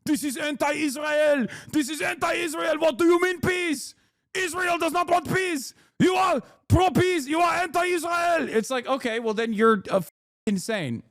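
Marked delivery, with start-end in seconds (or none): 0.0-8.5 high, frantic mock envoy with accent; Danny Danon. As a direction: parody a ranting official in a put-on accent. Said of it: "And this guy's like".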